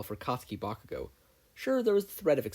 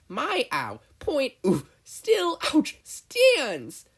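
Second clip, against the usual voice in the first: much higher and louder, yelps between words; yelping in pain; 9 dB louder, much slower than usual. Acted. Mei punching herself while insisting, yelping. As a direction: get hit mid-sentence.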